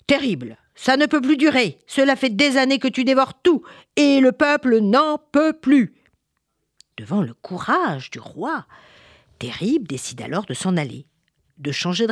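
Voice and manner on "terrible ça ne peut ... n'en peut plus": high, loud, indignant king; stern confrontation. Acted. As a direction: confront the monster with royal outrage.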